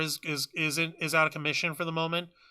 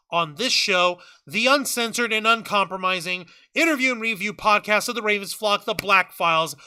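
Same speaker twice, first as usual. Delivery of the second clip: upbeat, higher announcer sign-off; podcast announcer; 4 semitones higher than usual. Acted. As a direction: pitch the outro like a radio host. Podcast outro sign-off read.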